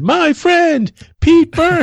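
high, drawn-out stage intro; announcer voice. Retold or imitated. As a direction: announce him like a big MC. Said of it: "I said".

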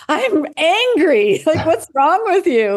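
high, slow, laughing mock outburst; amused imitation of an angry patient. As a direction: play the patient laughing at you. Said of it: "And they're like".

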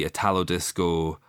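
usual speaking voice

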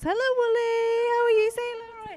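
high, sing-song call of hello; excited greeting. Real, not acted. Podcaster spotting a celebrity friend passing, high excited greeting.

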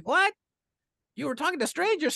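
high-pitched outraged question; mock a shocked, scolding mother; scolding mom. Mom overheard confronting her daughter, scolding. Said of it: "I hear the mom going".

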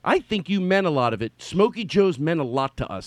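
ordinary talk

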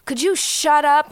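mock annoyed yell, high and slow; loud, drawn-out retold scolding; irritated complaint. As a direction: imitate someone fed up at being woken. Retells Naya's annoyed night complaint.

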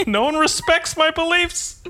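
very high, melodramatic comic voice; hammed-up high-pitched despair, edged with laughter; mock outrage. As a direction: play an absurdly high-voiced fanatic at breaking point. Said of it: "Someone's like".

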